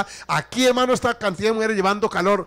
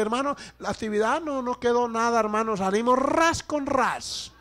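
high-pitched mock excuse; treasurer making excuses; 7 semitones higher than usual. Preacher mimicking treasurer telling church nothing's left, high mock excuse. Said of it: "pararse y decir".

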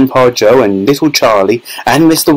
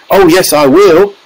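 high, defiant little squeak; squeaky tiny voice; 8 semitones higher, 4 dB louder than usual. A narrator's reading. Tiny boy contradicts his mother, defiant squeak. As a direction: squeak back cheekily, pitched high. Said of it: "squeaked the tiny voice".

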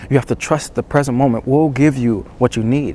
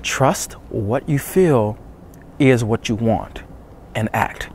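slow deliberate; much slower than usual. Real, not acted. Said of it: "now we gotta move into".

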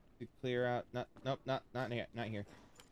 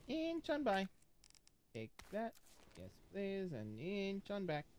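sneaky sing-song; 8 semitones higher, 7 dB quieter than usual. Pretend. Sneaking past, playful high voice.

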